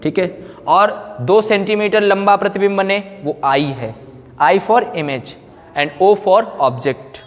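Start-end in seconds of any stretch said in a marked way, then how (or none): none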